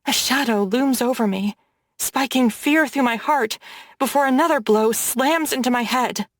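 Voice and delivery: plain reading voice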